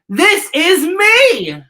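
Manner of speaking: high, loud, drawn-out proud cry; yelled proudly. Shown as a demonstration. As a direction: shout it out slowly like a proud declaration. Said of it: "Say it with me".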